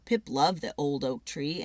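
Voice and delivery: ordinary delivery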